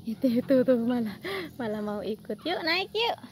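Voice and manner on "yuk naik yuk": higher sing-song coaxing with rising yuk; coaxing pet talk. Acted. Urging her squirrels to climb the tree, higher sing-song coax. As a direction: coax them up like a fond owner.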